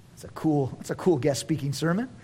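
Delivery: ordinary talk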